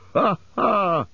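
high, crowing "ha, ha"; high-pitched acted cry of discovery; gleeful cry. A narrator's reading. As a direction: cry out in high mock triumph. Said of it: "came a cry".

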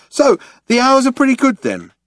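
high, eager interviewer; resume with forced interest; chummy prompting. A narrator's reading. Ford chats up the guard.

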